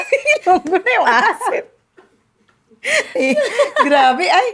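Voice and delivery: amused, drawn out through laughter; half-laughing about the accent. Real, not acted.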